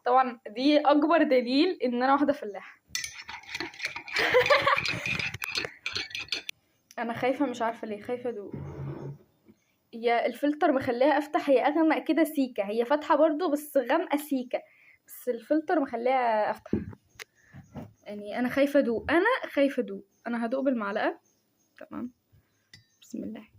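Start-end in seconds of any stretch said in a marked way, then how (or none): 3.3-6.5 soft laugh. Real, not acted. Laughs at herself being a peasant. 21.8-23.5 almost inaudible, much softer, bracing before the sip; a quiet prayer before nervously sipping the coffee; nervous hush. Real, not acted. Scared to taste the coffee.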